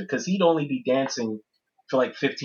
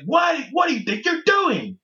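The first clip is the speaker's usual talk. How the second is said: high shout, much higher; 13 semitones higher than usual. Retold or imitated. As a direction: yell it out in outrage. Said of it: "And he's like".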